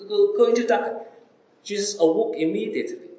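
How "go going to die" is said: a hammed-up cry of fear in the storm; frightened disciples. Disciples in the storm begging Jesus to save them, mock panic. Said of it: "when his disciples cried".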